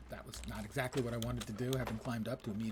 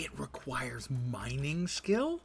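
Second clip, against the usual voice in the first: slow, louder disbelief, rising on skill; disbelieving question; 2 semitones higher, 5 dB louder, slower than usual. Real, not acted. Gamer rereads the skill requirement in slow disbelief. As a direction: drag it out, unable to believe it.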